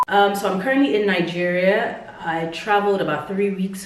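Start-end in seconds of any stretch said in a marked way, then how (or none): none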